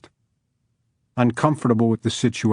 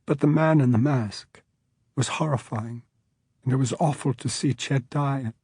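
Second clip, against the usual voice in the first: choking up; 3 semitones higher, 4 dB quieter than usual. Acted. Judge recalling the masked killer, choking up. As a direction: fight tears through each word. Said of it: "Choking up entirely".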